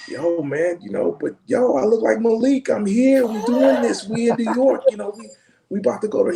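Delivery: high, insistent mock plea; retold pleading. Retells pleading with upset sound guy.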